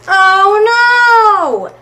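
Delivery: high, loud oh no; dismayed exclaim. Acted. Reading to children, cries out at the mess, high and loud. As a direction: gasp it out for the kids.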